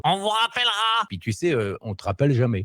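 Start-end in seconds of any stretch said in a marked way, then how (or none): 0.0-0.7 mock casting brush-off. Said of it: "C'est en gros".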